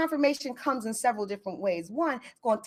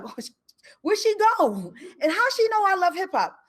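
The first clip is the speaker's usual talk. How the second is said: baffled; 10 semitones higher, 4 dB louder than usual. Retold or imitated. Baffled at vanished woman.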